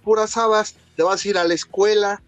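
mocking dad. Father mocking son who hoped to stay home, taunting. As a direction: scoff at the kid's hope. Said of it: "dad mocking the kid".

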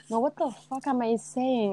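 ordinary talk, nothing marked

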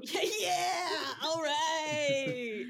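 laughing, shouted cheer; excited cheer. Real, not acted. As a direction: cheer along with the crowd.

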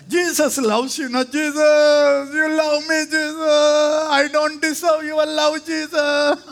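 high, loud, pleading; childhood prayer. Retold or imitated. Child praying to Jesus, high loud pleading. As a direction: cry out like a small child to Jesus. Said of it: "சின்ன வயசுல".

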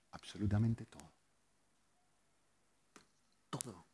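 very soft, low answer; quiet, weighty reveal; hushed emphasis. Real, not acted. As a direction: let it sink to near whisper. Answers own question in hush.

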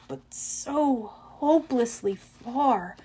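ordinary delivery

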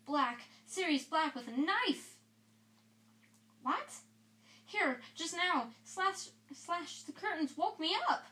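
high, frantic, panicked gasping; terrified. Acted. Ron terrified after waking.

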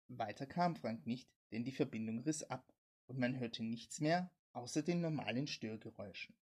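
plain reading voice

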